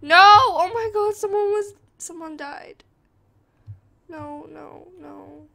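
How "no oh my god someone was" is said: sudden scream, much higher and louder; real frightened scream. Real, not acted. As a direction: shriek in real fright at the scare. Jump scare in horror game.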